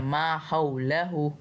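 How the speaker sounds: हौलहु recited slowly with a gliding हौ; slow recitation of मा हौलहु, हौ gliding; hau glide. Shown as a demonstration. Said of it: "हमने हऊ पढ़ना है". (मा हौलहु में हौ को लीन के साथ पढ़ें)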